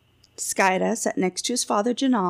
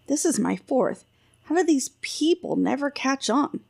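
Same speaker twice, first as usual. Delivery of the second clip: usual speaking voice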